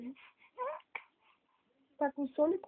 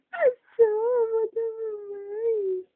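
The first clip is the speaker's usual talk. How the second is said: excited peekaboo; 7 semitones higher, 6 dB louder, slower than usual. Acted. Peekaboo reveal to baby.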